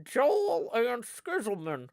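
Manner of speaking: high ventriloquist puppet voice. Acted. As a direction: make the puppet talk, high and excitable. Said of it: "I got my puppet pals here".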